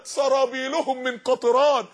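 ordinary talk, nothing marked

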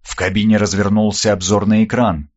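ordinary delivery, nothing marked

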